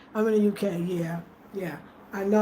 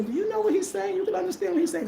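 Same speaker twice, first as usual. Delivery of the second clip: much higher, astonished asking; high-pitched disbelief at her understanding; amazed quote; 10 semitones higher, faster than usual. Retold or imitated. People asking in amazement, high and astonished. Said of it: "I have people say".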